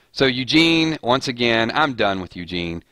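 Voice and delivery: ordinary talk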